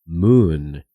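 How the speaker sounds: moon stretched slowly as mun; slow model of moon; English pronunciation. Shown as a demonstration. Teacher models the word for learners, slowly. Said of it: "se pronuncia".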